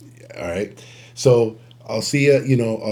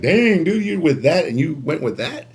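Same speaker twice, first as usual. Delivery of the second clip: mock disbelief; 5 semitones higher than usual. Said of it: "you're like".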